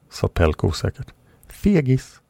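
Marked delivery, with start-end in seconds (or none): 1.5-2.2 high, teasing jab with a grin; mock him with a smirk; grinning tease. A narrator's reading. Sila teasing shy Pelk, grinning and high. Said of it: "flinade hon".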